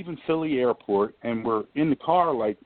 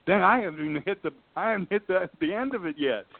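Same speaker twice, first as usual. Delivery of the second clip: higher and fast, barely containing glee; giddy excitement; 9 semitones higher, faster than usual. Retold or imitated. To himself as the offers keep rising, giddy and fast. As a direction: rush it out like you can't believe your luck. Retelling his giddy inner thoughts.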